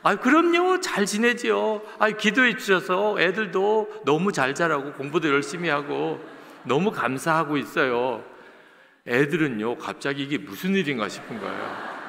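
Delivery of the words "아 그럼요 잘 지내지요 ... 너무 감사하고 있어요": a sugary, much higher and louder mother on the phone; sweet phone voice. Retold or imitated. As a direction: turn all honey for the pastor's call, pitched up. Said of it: "옥구슬 굴러가듯이".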